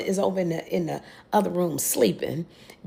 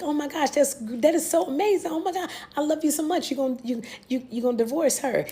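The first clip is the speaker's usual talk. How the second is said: mock giddy delight; 6 semitones higher than usual. Voicing the thrilled mistress.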